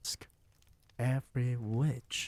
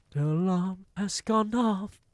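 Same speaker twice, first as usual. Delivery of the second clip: higher, louder gleeful witch; lightly voiced mock scream; Grand High Witch screaming; 10 semitones higher, 7 dB louder than usual. A narrator's reading. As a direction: crow it like a witch.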